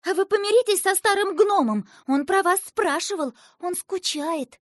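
put-on girl blurting out her plea, excited; blurted out. A narrator's reading. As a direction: blurt it before courage fails. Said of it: "выпалила".

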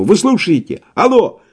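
high calling into receiver; shouting into phone. A narrator's reading. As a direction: shout down the line. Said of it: "опять закричал в трубку". (кричит в трубку)

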